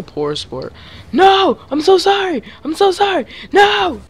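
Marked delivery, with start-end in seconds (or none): 1.1-4.0 very high, loud squealing; high-pitched wailing apology; panicked apology. Real, not acted. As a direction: shriek it in frantic dismay. Wailing apology after a mistake.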